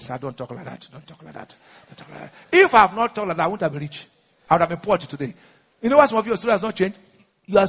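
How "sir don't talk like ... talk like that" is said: low, very soft pleading aside; hushed retold caution. People telling the preacher not to boast, hushed caution. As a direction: imitate people quietly cautioning him, fading away.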